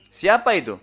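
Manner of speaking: retold call of who goes there. Target calls back in the dark.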